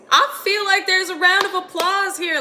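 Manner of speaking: high, loud swell of emotion; moved, pitched well up and coming down; emotional. Real, not acted. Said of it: "I'm getting a little emotional".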